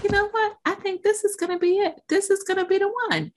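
high, rapid, hopeful rush; eagerly convince yourself this is the winner; excited. Acted. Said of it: "you get excited about it".

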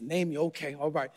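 hushed, let-down acceptance; deflated mumble. Retold or imitated. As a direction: drop low and quiet, flat resignation. Deflated reply to being named.

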